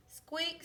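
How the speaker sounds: high little squeak; mouse squeak. A narrator's reading. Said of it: "said Mouse".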